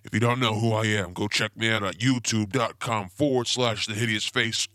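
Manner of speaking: ordinary delivery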